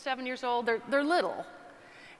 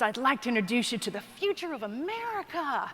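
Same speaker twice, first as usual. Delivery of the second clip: grand announcer voice, swooping pitch on the final words; rodeo announcer; 3 semitones higher than usual. Retold or imitated. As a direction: introduce them to the arena like a showman. Rodeo announcer introduces kids to crowd.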